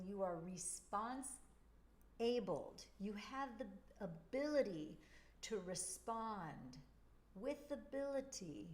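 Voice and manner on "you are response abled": "response-abled" drawn out slowly, split as response plus abled. Shown as a demonstration. To listeners, slowly splitting "response-abled" into response and abled. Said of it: "when you are responsible".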